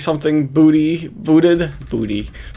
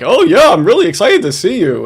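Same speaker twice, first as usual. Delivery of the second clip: higher-pitched mocking fake enthusiasm; mock excitement; 6 semitones higher, 4 dB louder than usual. Host mimicking the man keen to see her, mock excitement. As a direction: imitate a phony eager suitor.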